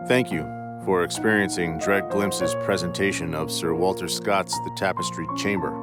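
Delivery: plain reading voice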